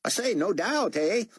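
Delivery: excited Australian. A narrator's reading. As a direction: gush about the resurrection like an awed Aussie fan. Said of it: "his accent making David guess he was an Aussie".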